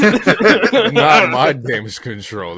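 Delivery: loud, drawn out through laughter; laughing echo. Real, not acted. Amused listener echoing co-host's complaint, laughing. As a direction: repeat it slowly, cracking up.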